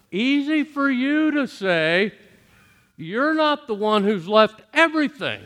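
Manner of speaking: high, incredulous retort; exasperated disciples. Retold or imitated. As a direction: push back, half hurt, half annoyed. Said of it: "but they were thinking".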